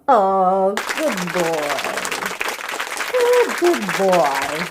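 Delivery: low, cooing praise for a dog; tender praise. Real, not acted. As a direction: coo it lovingly and slowly.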